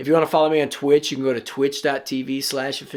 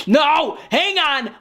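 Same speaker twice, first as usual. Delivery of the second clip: much higher, louder yelp; panicked yell; 12 semitones higher, 4 dB louder, slower than usual. Real, not acted. About to die in the zombies match, much higher panicked yell. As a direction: panic as the game turns.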